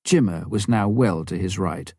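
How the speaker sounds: ordinary delivery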